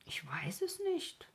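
high, soft note of surprise; puzzled wonder. A narrator's reading. Rico realising he lacks the lake's name, puzzled. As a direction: say it wondering at yourself. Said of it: "sagte er selbst verwundert darüber".

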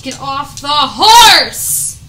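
loud slow shout; angry shout. Real, not acted. Gamer shouting at the rider on screen, angry. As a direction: bark the order at the rider.

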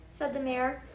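usual speaking voice

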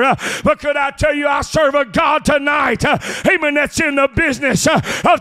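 loud, driving sermon cadence with uh breaths; preaching at full force. Acted.

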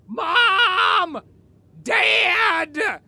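shouting for parents across the house. Acted. Calling out for parents.

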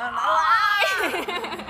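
excited announcement. Acted. Announcing a guest to viewers.

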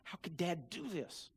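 low, very soft resentful mutter; sulking brother. A narrator's reading. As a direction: grumble it under your breath, hurt. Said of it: "He was so mad".

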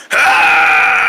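sustained rough exhaling cry; drawn-out breath-blast yell; long yell. Acted. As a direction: let out one long, forceful ahh. Long blow at the fire.

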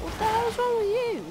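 very high, furious yell; angry shout. Real, not acted. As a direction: yell at the other player. Angry at opponent.

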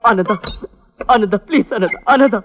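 urgent, broken-up begging; pleading. Acted. Man begging his wronged friend to forgive him, pleading. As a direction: call his name over and over, imploring.

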